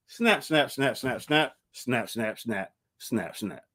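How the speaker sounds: repeated words fading from plain to soft and low; fading refrain. Acted. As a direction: let each snap drop away into silence. Poem's closing refrain fading out.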